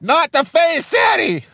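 put-on very high-pitched child's shout; mock pissed-off kid. Dad imitating his son hit by a Nerf dart, pissed kid's yell. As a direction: imitate an indignant little boy yelling. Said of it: "No, he's pissed. He's like".

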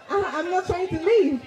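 high, laughing protest; through laughter. Real, not acted. Storyteller admitting she didn't want to leave, laughing. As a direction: say it while cracking up.

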